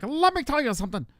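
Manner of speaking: high-pitched manic comic impression; mock him with a high cartoon catchphrase; Fire Marshal Bill. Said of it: "talks like Fire Marshal Bill".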